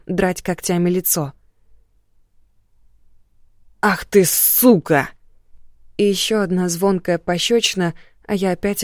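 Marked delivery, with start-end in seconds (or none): 3.8-5.1 медленно, яростно; выплюнь ругательство; злой крик. Acted. Муж взрывается после удара. (яростный крик)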